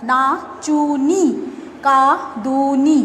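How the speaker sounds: each syllable drawn out slowly; slow syllable demo. Shown as a demonstration. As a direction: stretch every syllable for the class. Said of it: "আমি যখন নাচুনি শব্দটা উচ্চারণ করছি".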